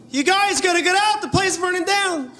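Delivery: bright and high, ignoring the danger; mismatched cheerful voice. Acted. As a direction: announce disaster like good news. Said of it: "a guy who doesn't know how to match his face to what he's saying".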